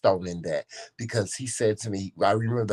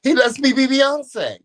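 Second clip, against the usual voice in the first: high, loud, triumphant crowing; gleeful boast; 8 dB louder than usual. Real, not acted. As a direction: crow it with delight. Gleeful boast after the laughter.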